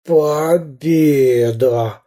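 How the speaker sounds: very slow, moaning; groaned victory. A narrator's reading. Pirate Bul-Bul groans victory in pain, moaning. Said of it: "простонал капитан Буль-Буль".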